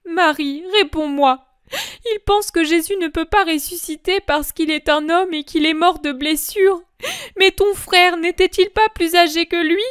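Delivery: a mother refusing to believe her son is gone; heightened voice of the grieving mother; grieving Mary. Acted. Mary pressing Magdalene.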